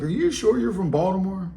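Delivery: high mock-puzzled interviewer voice; imitating the host. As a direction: mimic the host doubting him. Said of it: "and he's like".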